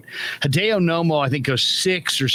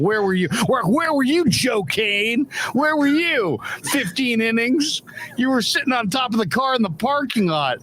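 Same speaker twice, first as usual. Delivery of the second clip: much higher, sing-song ribbing; mocking taunt; 8 semitones higher than usual. Teasing Joe Kane for missing the game, mocking taunt. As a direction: needle your buddy with the commercial line.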